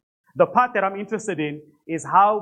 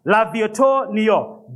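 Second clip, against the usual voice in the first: second try at French accent; much slower than usual. Shown as a demonstration. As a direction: try the French name again with more accent. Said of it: "let me get that French accent going".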